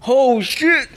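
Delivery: high surprised gasp of a curse; shocked. Retold or imitated. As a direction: react like you just saw old photos. Friend reacting to old pictures.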